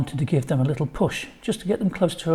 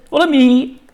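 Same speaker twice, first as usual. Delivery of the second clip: schoolboy voice; 11 semitones higher, 10 dB louder than usual. A narrator's reading. Boy leading the stranger.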